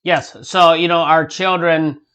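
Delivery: ordinary talk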